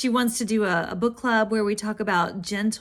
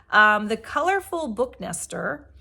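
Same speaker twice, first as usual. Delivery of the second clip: usual speaking voice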